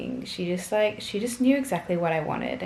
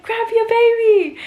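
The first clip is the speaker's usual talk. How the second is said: urgent call; 11 dB louder than usual. Retold or imitated. Retelling midwife's urgent instruction.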